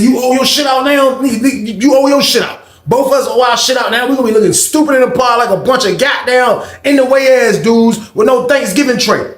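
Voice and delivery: loud, high exasperated yelling; angry shout. Retold or imitated. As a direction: scold him hard for losing the tray. Yelling at cellmate over tray.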